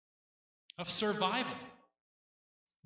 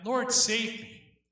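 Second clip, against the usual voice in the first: a slow, louder plea, pitched higher; crying out; 6 semitones higher, 8 dB louder, slower than usual. Retold or imitated. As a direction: call out to the Lord for rescue, drawn out. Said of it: "he cried out".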